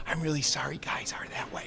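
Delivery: hushed whisper with a laugh in it; whispered aside. Retold or imitated. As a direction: lean over and whisper it to your neighbour, half laughing. Leaning over to lady during keynote.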